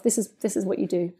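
soft, murmured "This is, this is what you do." to oneself; calm your own nerves in a hush; hushed private reminder; quiet self-talk. Retold or imitated. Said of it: "I just tell myself".

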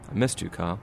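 gentle sincere confession; tender. Acted. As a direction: quietly tell your old friend you missed him. Emotional reunion of old friends.

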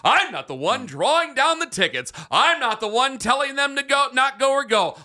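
high-pitched, insistent self-defence; heated protest. Real, not acted. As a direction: protest your innocence, pitch way up. Heated self-defence after being accused.

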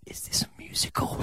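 whispered question in a cinema; hushed cinema whisper. Retold or imitated. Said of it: "turns to me and says".

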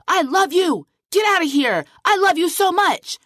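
hammed-up angry voice saying I love you; harsh tone. Shown as a demonstration. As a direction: bark loving words so they scare the dog. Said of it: "My dog would run away from me afraid".

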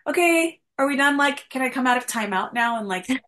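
high, loud restless whining, lightly mocking herself; impatient. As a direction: act like a fidgety kid pleading to leave timeout. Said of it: "I'd be like".

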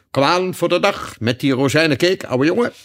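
high-pitched, chummy demand; jaunty unicorn calling for cake. A narrator's reading. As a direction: chivvy an old pal along, pitch up. Unicorn chivvies the king for cake.

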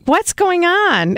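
high, slow, drawn-out puzzlement; confused kid voice. Retold or imitated. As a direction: stretch it like a baffled child. Retold childhood confusion.

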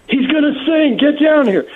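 high, urgent call to come down; retold call to come quick; excited call. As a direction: shout the news across the bar to the crew. Calling everyone down to the bar.

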